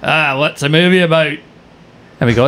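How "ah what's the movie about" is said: higher-voiced Scottish cabbie; thick Scottish accent. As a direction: imitate a curious Scottish driver. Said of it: "thick Scottish accent cab driver".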